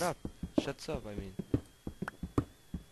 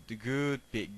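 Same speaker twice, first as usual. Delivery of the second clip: normal talking voice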